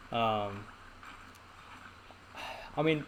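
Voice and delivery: ordinary delivery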